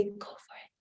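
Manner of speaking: hushed, breathy whisper; barely audible urging whisper; inner voice whisper. Acted. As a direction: whisper it like a secret urge from inside. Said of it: "something inside of me would say".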